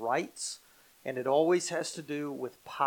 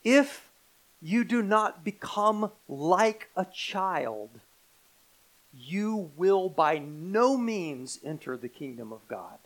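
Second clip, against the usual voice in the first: slow declaration with a punched "If"; very emphatic; slower than usual. Acted. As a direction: stretch "no means" with deliberate weight. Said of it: "Very emphatic".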